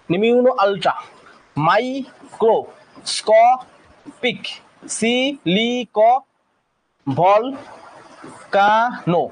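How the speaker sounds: slow, laughing syllable drill. Shown as a demonstration. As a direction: lead the class through each syllable. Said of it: "সিলেবালটা দেখলে".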